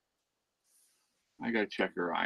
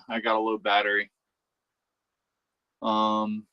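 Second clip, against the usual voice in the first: louder, brighter talk into the phone; 8 dB louder than usual. Real, not acted. Calling her mom from the shed, louder phone voice. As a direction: speak up on the call.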